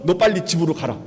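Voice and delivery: ordinary talk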